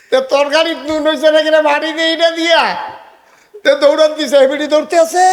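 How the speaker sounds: high, loud scolding rant; heated, mocking outburst from the pulpit; indignant preaching. Real, not acted. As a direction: shout the rebuke to the congregation. Scolding a wife-chasing husband.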